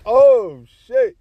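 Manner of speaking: loud, high "oh", drawn out; shocked reaction. Retold or imitated. Friend overhearing a brutal insult, shocked. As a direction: act out an onlooker's amazement.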